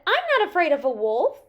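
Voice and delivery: put-on high, loud boyish boast; defiant young Peter. A narrator's reading. Peter talks back to grandfather, high and defiant. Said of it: "Peter said".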